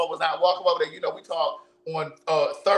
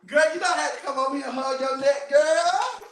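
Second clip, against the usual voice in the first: excited greeting; 8 semitones higher than usual. Real, not acted. Greeting friend in playback.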